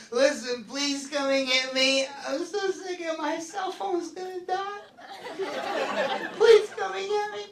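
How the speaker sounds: put-on high, slow whiny drunk plea; mock tearful begging to be picked up. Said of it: "she'll go".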